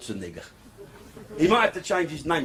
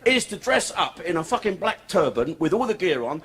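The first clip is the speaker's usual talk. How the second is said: usual speaking voice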